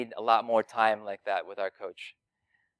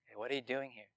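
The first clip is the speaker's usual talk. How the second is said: softer, casual God; 13 dB quieter, faster than usual. Shown as a demonstration. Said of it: "watch [name]'s talk".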